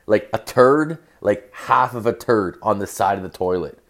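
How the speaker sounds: normal talking voice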